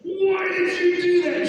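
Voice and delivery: high, loud, drawn-out mock protest; slow, high, hammed-up shout; outraged wail. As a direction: wail it at your mother in exaggerated disbelief. Retold outraged protest to prankster mother.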